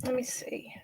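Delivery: breathless unvoiced muttering; whispered to herself. Real, not acted. Sorting out the stream settings alone, whispering to herself. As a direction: whisper to herself while fiddling with the screen.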